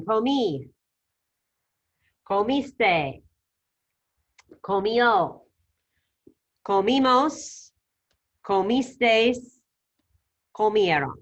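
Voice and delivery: "Comimos" and "Comisteis" slow and drawn out, the others clear and spaced; model for repetition. Shown as a demonstration. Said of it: "All right, so repitan".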